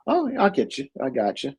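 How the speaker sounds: usual speaking voice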